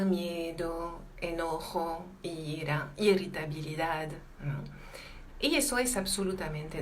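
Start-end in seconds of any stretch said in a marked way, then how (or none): none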